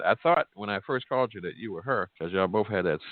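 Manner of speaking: ordinary delivery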